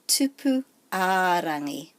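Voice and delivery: "tupu a rangi" with a long a; stress on A. Shown as a demonstration. Teacher giving a similar name, long stressed a in "tupu a rangi". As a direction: hold the middle a in "tupu a rangi". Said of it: "So there's an emphasis there".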